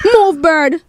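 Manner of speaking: high, quick yelp at the bird; startled squeal. Acted. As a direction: jump back from the crow with a squeal. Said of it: "squealed Tyrone".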